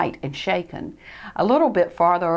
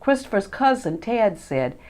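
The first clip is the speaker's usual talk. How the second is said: ordinary delivery, nothing marked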